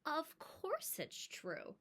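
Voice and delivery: gently unhurried grandma; softly, slowly, like an old grandmother; grandmother answering. Acted. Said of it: "She'd answer".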